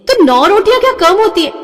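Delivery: shocked daughter squealing in protest; indignant outburst. Acted. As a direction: throw the number back at her, outraged. Daughter protests nine rotis.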